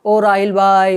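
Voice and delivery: mock call to someone across the room; calling from afar. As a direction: imitate hailing someone at a distance. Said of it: "دور سے اس کو آواز دیتا ہے".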